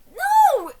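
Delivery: high, loud objection; sharp no. Real, not acted. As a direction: cut in loudly. Loud interjection.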